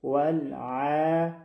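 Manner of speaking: ولع chanted slowly with a soft mid-throat ayn; slow, unblocked tajweed ولع; soft, clear ayn. Shown as a demonstration. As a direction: let the ayn in ولع flow long, no catch. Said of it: "نرم، بدون اینکه کدام بندشی".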